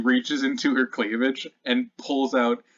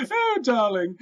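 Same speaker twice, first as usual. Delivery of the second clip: coy deflection; 10 semitones higher, 4 dB louder than usual. Acted. Bertha deflects a question.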